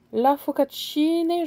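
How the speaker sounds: La focaccione said slowly, each syllable drawn out and careful; tentative, slow focaccione, testing the word; unsure Italian word. Real, not acted. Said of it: "je ne sais pas si je le prononce bien".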